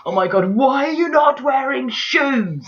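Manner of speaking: high, slow exasperated yell; exasperated shout. Retold or imitated. Annoyed at the hero, high slow exasperated yell. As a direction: shout it at the screen. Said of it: "sitting there going".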